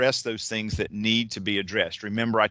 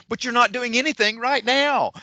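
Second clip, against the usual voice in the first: high, loud mock protest; exasperated retort; 13 semitones higher, 5 dB louder than usual. Coach retelling his reply to a client, exasperated retort. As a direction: push back in high-pitched, incredulous exasperation.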